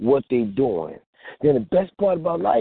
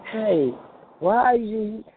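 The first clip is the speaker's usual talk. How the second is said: lightly voiced meek little mouse; slightly higher, timid mousy voice; a hint of a small, timid voice; 6 semitones higher, much slower than usual. Shown as a demonstration. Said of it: "sound like a little mouse".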